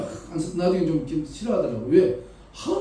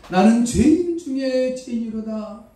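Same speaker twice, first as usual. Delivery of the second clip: higher, louder, slow 죄인 stressed; Paul crying out; 7 semitones higher, 5 dB louder, slower than usual. Retold or imitated. Paul crying out his confession, loud with 죄인 stressed. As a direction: cry out 죄인 with force. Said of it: "바울이 말합니다".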